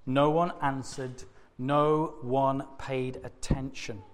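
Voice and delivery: ordinary delivery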